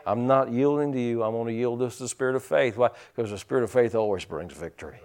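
normal talking voice

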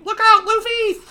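high, loud mock cry; alarmed warning. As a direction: shout a shrieked warning.

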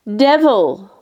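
outraged cry of disgust; furious exclamation. A narrator's reading. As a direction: hurl the word out in rage. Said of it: "I exclaimed".

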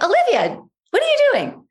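puzzled mother. Retold or imitated. Mother voiced, puzzled at child.

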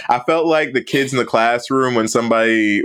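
ordinary talk, nothing marked

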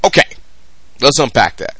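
normal talking voice

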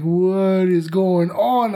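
very slow, groggy half-awake question; groggy slow bewildered question; dazed confusion. Retold or imitated. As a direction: drag it out like you just woke up. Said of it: "And I'm like".

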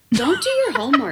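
high laugh through the words. Real, not acted. Retelling giving up on the kids, laughing high. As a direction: crack up mid-line. Laughs while quoting herself.

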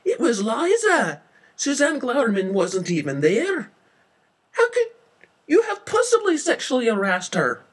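very high, loud outburst; indignant protest. Acted. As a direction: protest loudly in high-pitched disbelief. Witness protests the false accusation.

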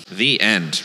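ordinary delivery

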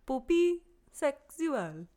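"Poupée sexuelle" said slowly as poo-pay sex-oo-el, a weak French accent; French phrase, slow and careful. Shown as a demonstration. Host reads the French search term, a halting French accent. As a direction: read "Poupée sexuelle" with a hesitant attempt at French. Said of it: "Can you say it in a better French accent?".

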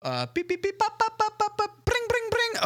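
high beeping dial tones and ringing; phone dialing noises. Pretend. Calling an escort service.

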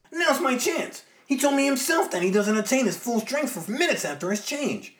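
high, loud and determined; psyched-up hero, fist clenched; Spider-Man voice. Acted. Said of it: "who right fist clenched thinks".

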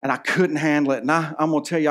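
ordinary delivery

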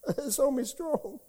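real crying with a little laugh. Real, not acted. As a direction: speak as tears come unexpectedly. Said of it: "I don't know why I'm crying".

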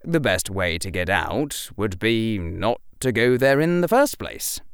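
ordinary delivery

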